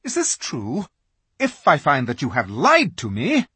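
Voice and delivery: high, menacing witch voice; threatening witch. A narrator's reading.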